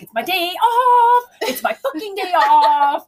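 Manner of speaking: high, loud, slow indignant whine; mock exasperated whine. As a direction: protest like a put-upon worker on her day off. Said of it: "she's like".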